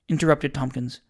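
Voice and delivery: ordinary delivery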